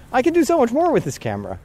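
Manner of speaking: high, fast and eager; excited thought. Retold or imitated. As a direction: blurt it out excitedly. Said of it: "'cause I feel like".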